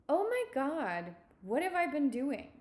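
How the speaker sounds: a bright aha moment; light realization. Pretend. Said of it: "you know what it's gonna feel like".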